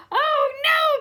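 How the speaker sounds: team's panicked wail; frightened cry. Acted. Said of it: "cried the team".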